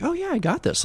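breezy, high-voiced know-it-all; cocky. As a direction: toss it off like it's easy. Mimicking an overconfident new graduate.

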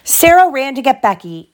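animated storybook reading; lively bedtime-story voice; story narration. A narrator's reading. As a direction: read aloud to a child, lifting the characters' lines. Reads story to child.

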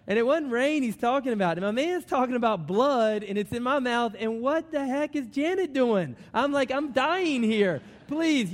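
loud, high, worked-up outburst; exasperated, pleading comic rant; mock panic. Acts out panic in the chair.

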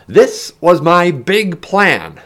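slow, high, loud shout; shout over wind. A narrator's reading. Ben tells Heron his plan, shouting over wind. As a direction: shout it over rushing air. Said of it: "Ben shouted over the rushing air".

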